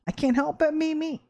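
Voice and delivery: normal talking voice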